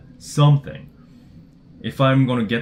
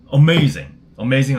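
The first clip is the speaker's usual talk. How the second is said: excited; 4 semitones higher, 8 dB louder than usual. Real, not acted. Excited reaction to car idea.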